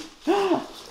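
sharp high gasp of delight; delighted gasp at a rare find; excited gasp. Real, not acted. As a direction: gasp with thrilled surprise. Finds a rare snail figure.

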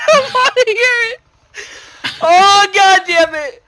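high, loud wail through laughter; real tearful laughing cry; dying of laughter. Real, not acted. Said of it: "I'm gonna die from laughter".

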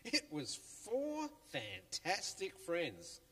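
usual speaking voice

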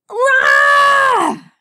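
long, high, loud scream of exasperation; frustrated yell. A narrator's reading. Rainbow at her wits' end diving off the platform, frustrated yell. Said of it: "Rainbow shouted at her wits' end".

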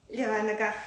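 ordinary talk, nothing marked

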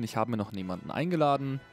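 ordinary delivery

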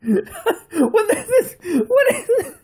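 high, frightened laughing; nervous laugh. Real, not acted. Startled by the horror game.

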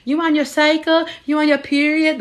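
high teasing schoolboy voice; mocking boys. Boys teasing the girls about periods, high mocking taunt. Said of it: "the boys would be like".

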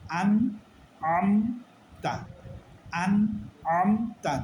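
slow drill, mid-throat ain opening each "আমতা"; clear hamza-ain contrast. Shown as a demonstration. Teacher drilling the correct letters, "আমতা" with a distinct mid-throat ain. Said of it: "বলতে হবে".

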